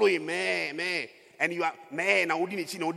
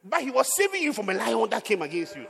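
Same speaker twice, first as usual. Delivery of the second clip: fervent preaching; 7 semitones higher, 8 dB louder than usual. Real, not acted. Preacher building to climax.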